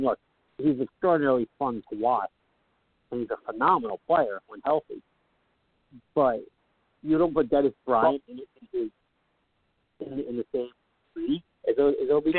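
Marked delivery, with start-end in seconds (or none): 6.1-12.4 real indistinct mumble; mumbling. Real, not acted. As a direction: mumble it so the words get lost. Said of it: "[name], you're mumbling".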